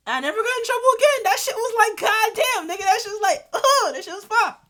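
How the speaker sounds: high, loud hyped yelling; reliving the movie treat, yelling with glee; excited shout. Retold or imitated. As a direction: shout it out, thrilled to bits. Thrilled about movie reward.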